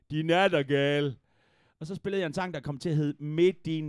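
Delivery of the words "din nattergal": low, slow imitated drunken holler; half-drunk Jutlander's shout. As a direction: drag it out like a tipsy heckler from Jutland. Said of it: "halvfuld jyde, der råbte".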